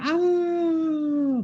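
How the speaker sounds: a high, drawn-out call fading into the distance; valley echo call. Retold or imitated. Teacher imitates a voice echoing from the valley. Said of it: "दूर तक आवाज ही आ रही है बस".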